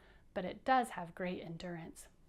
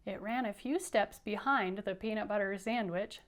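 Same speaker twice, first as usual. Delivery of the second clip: usual speaking voice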